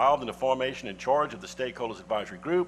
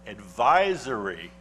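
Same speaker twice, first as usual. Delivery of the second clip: advisory drawn out slowly; stress on advisory; 4 dB louder, slower than usual. Real, not acted. Councilman reading the law to colleagues, advisory slowly emphasized. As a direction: lean hard on the word advisory, stretching it. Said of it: "I emphasize the word advisory".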